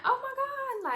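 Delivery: a sudden very high, loud cry of relief; overjoyed outburst. Retold or imitated. Mother-to-be hearing she can keep her mom, overjoyed high exclaim. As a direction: burst out with joy at the good news. Happy news from the nurse.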